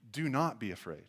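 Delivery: low, soft, firm statement; quietly firm, pitch dropped; emphatic statement. Retold or imitated. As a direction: state it as a settled command. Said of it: "It's a more emphatic statement".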